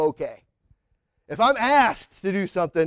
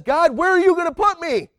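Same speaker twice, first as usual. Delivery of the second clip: much higher and louder, keen to be sent; eager mock prayer; 12 semitones higher, 7 dB louder than usual. Preacher voicing a willing servant asking God, high and eager. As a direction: ask God eagerly where he'll send you.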